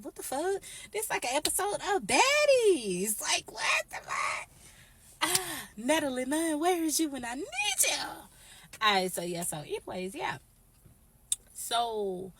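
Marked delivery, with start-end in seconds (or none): 0.0-8.0 high-pitched theatrical freak-out; mock shock. Teller calls on Natalie Nunn amid the drama, high mock shock. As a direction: squeal it out, hammed-up.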